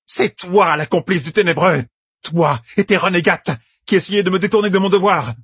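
high-pitched furious counter-accusation; angry cry. A narrator's reading. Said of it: "cria Elaïda". (accusation criée avec colère)

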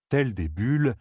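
plain reading voice

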